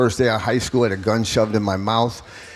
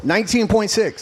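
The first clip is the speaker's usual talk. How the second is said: sharp corrective emphasis, pitched up high; pointed correction; 10 semitones higher than usual. Real, not acted. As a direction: correct the number firmly and pointedly. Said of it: "Excuse me".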